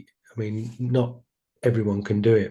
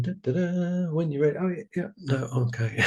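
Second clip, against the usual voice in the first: mock sing-song dithering, higher pitched; dismissive dithering; 6 semitones higher, faster than usual. Imitating the hesitant self, higher mock sing-song dithering. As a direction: play someone stalling and waving it off. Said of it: "sitting there going".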